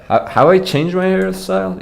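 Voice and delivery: normal talking voice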